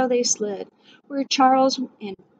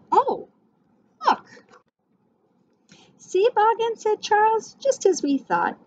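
much higher, excited voice of discovery; delighted wonder; 10 semitones higher than usual. Acted. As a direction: cry out in joyful wonder. Charles finds the tree.

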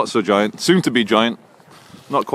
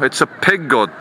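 ordinary delivery

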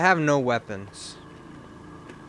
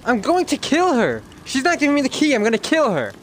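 higher, louder furious rant; 10 semitones higher, 4 dB louder than usual. Real, not acted. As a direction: seethe out loud at the game. Raging at a game opponent.

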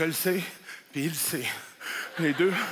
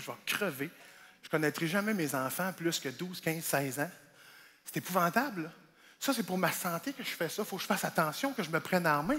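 softer, low and sober; sober and quiet; 7 semitones lower, 6 dB quieter than usual. Real, not acted. Comic turning serious about his weight, softer and sober. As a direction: let it sink in, quietly.

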